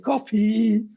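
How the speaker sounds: calling out. Retold or imitated. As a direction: announce the coffee from outside the room.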